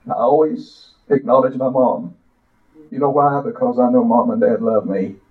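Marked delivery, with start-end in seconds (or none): none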